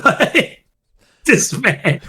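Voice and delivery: high laughing aside. Real, not acted. Friend laughing about the wrestler. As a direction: laugh through the words.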